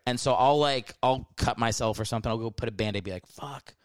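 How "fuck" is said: very soft swear under the breath; retold curse, barely voiced; muttered curse. Muttered curse while bandaging cut.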